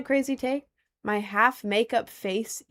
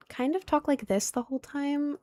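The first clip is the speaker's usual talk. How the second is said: put-on higher, girly voice; artificially raised pitch demo; forced higher voice; 5 semitones higher, 5 dB quieter than usual. Shown as a demonstration. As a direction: push the voice up, lightly and softly. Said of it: "force a higher voice".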